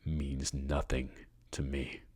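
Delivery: slow, dismissive menace; controlled villain, in no hurry; terrifyingly calm. A narrator's reading. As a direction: drag the words out, letting them drop away. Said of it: "He was terrifyingly calm".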